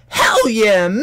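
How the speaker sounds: high, loud "Hell yeah"; excited shout. Retold or imitated. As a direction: yell it out with excitement. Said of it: "And I was like".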